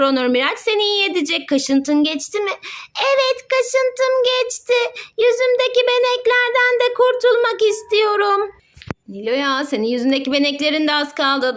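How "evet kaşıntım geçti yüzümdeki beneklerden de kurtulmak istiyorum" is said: voiced toy patient. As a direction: play a relieved little toy patient. Mother voices toy Nilo'ya.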